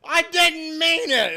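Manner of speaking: high, slow hammed-up whine; mock wail. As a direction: wail it like a hurt child. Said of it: "another girl came on".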